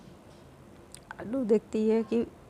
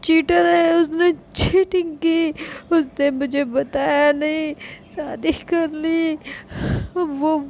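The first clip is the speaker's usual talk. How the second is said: put-on drunk yelling; 2 semitones higher, 6 dB louder than usual. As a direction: yell cheater at everyone, drunkenly. Drunk Yes shouting cheaters.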